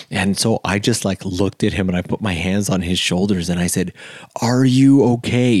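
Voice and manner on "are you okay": louder, higher retold check-in; emphatic concern. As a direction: raise your voice to get through to someone. Firmly checking on the upset drunk.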